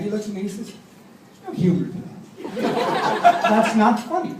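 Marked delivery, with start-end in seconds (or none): 1.5-4.3 deadpan. Retold or imitated. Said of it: "So Dad's deadpan".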